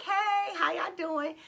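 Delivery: high, drawn-out friendly hey; outgoing, flirty greeting. Retold or imitated. Flirt greeting two men at the resort, outgoing and high-pitched. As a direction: sing out a bubbly hello, pitched high. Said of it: "I'm naturally outgoing, vivacious, a flirt".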